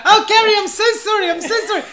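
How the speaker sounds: put-on high, fast, loud old-lady apology; flustered old dear. Old woman apologising to electrocuted Gary, high flustered voice. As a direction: flap and apologise in a shrieky mock old-woman voice. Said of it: "this old dear. She was completely mad".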